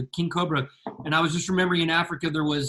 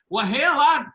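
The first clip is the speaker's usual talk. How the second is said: imitating a boastful good old boy, pitched high; loud, higher put-on storyteller voice; Big Tom; 5 semitones higher, 3 dB louder than usual. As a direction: play the unbothered snake catcher, voice up. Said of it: "That sounds like Big Tom".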